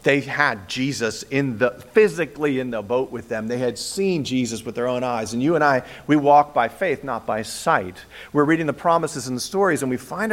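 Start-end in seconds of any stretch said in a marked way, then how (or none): none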